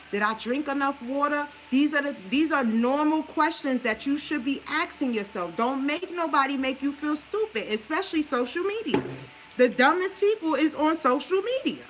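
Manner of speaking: ordinary delivery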